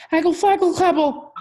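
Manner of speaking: put-on high, loud toddler gibberish; baby babble. A narrator's reading. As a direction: squeal it like a baby who can't talk yet. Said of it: "Trixie turned to her daddy and said".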